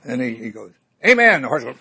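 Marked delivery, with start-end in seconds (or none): 1.0-1.4 loud, high desperate cry; panicked shout. Acted. Rider remembering the stop command at the precipice, frantic. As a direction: blurt it out to stop the horse. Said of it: "he's really panicking this time".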